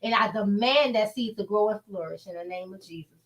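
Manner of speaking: usual speaking voice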